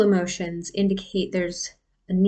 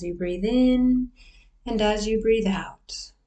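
guided meditation. Acted. Leads a breathing meditation.

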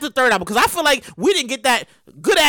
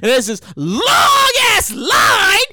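dismayed; 7 semitones higher, much slower than usual. Acted. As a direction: stretch it out, appalled. Seeing the long concession line.

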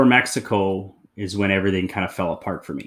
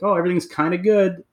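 mocking past self; 9 semitones higher than usual. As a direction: play your old self brushing it off, lightly. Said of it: "I'd be like".

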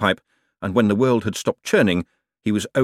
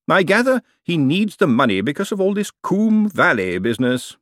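lightly voiced fussy lawyer, higher; smooth, knowing lawyer holding forth; lawyer's voice; 6 semitones higher than usual. A narrator's reading. Lawyer explaining the lease to Miss Dearhart, lightly voiced.

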